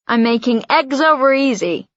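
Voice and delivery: lightly hammed American accent; Dad slips into a jokey American accent; a hint of an American accent for breakfast. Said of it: "he says in an American accent".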